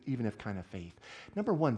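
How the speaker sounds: usual speaking voice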